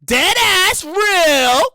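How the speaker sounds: high, very drawn-out Brooklyn vowels. Shown as a demonstration. Comic topping off the covid warning, exaggerated Brooklyn voice. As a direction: stretch each word in hammed-up Brooklyn. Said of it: "that's my Brooklyn voice".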